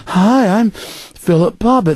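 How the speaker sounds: mock-nice, high, drawn-out greeting; slow, sugary put-on friendliness; Philip Bobbitt. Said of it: "says it real nice in a nice".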